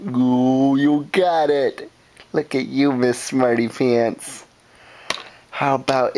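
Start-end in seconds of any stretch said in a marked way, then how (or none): none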